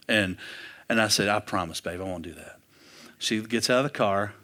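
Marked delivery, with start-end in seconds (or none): 1.3-2.6 low, soft, retold reassurance; hushed, low-pitched promise; quiet promise. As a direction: promise it softly under your voice. Retold promise to his girlfriend.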